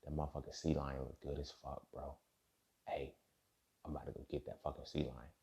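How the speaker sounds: a predator murmuring as it stalks; hushed hungry orca. Retold or imitated. Wild orca spotting a sea lion, hushed and hungry.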